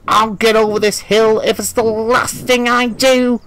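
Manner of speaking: high, determined gritted vow. A narrator's reading. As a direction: push it out through gritted teeth. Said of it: "gritted James".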